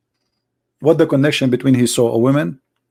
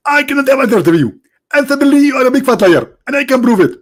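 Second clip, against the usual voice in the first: much higher, indignant preacher voice; Zakir Naik impression; 7 semitones higher, faster than usual. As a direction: play the annoyed preacher being called. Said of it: "Doctor Zakir Naik".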